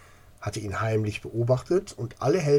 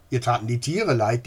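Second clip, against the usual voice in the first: usual speaking voice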